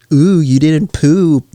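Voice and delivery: mock jeering "ooh"; mocking tease. Friends trolling him, acted quote.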